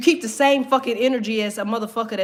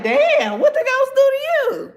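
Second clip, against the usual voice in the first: high, louder startled question; mock startled; 13 semitones higher, 4 dB louder than usual. Kid hearing granny cursing ghosts, mock startled. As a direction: squeak it out, spooked. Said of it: "You like".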